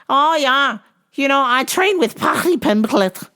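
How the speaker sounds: high, excitable bad Scouse; squeaky amateur Liverpool accent; terrible Liverpool accent. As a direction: pitch it up into a hammed-up Scouse voice. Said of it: "terrible accent".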